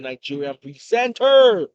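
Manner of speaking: loud, showy host sign-off; hype announcer. Real, not acted. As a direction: belt out your own title, drawn out and loud. Host's showy sign-off self-introduction.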